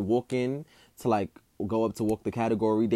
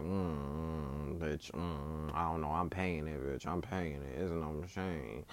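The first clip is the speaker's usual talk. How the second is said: a lightly voiced cartoon-kid grumble, lower and softer; Bart Simpson noise; 5 semitones lower, 11 dB quieter, much slower than usual. Backing out of the tag team event, a soft mock Bart Simpson grumble. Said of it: "I started giving that Bart Simpson noise".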